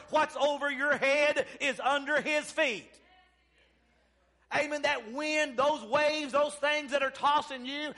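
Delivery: rousing revival exhortation; full-force preaching. Real, not acted. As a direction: preach it hard, stretching key words.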